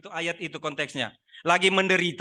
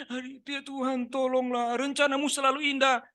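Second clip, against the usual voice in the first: high mock-tearful prayer; merengek menangis; 6 semitones higher than usual. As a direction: whine a plea to God, badly faked sobbing. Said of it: "kok nangis merengek-rengek?". (doa merengek pura-pura menangis)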